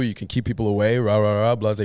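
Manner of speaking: usual speaking voice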